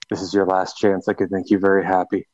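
low, intimate mock come-on; mock seductive whisper-close. To the groom at the altar, a low mock-seductive murmur.

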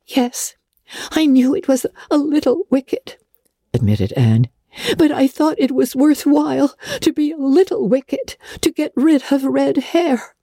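put-on high, earnest young girl; a guilty girl justifying herself. A narrator's reading. Anne admitting and explaining.

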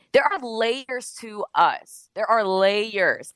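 emphatic slow delivery; slow and deliberate. Real, not acted. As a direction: stretch each word out slowly.

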